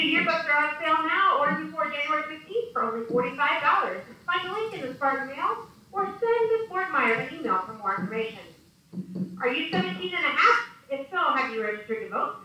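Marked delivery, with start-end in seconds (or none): none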